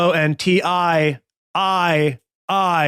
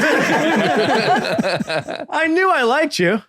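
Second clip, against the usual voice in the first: laughing; 7 semitones higher, much slower than usual. Real, not acted. Laughing at the reveal.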